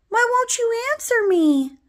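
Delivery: put-on very high, loud little-boy plea; high-pitched, insistent put-on child; little brother's voice. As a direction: demand an answer in a small child's voice. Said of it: "I hear my brother say".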